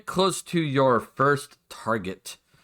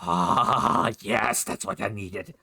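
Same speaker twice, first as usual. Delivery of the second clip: slow, low sigh of satisfaction; relieved goblin addict; 4 semitones lower, much slower than usual. Acted. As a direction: savour it slowly, voice dropped low. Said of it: "the smacking of lips".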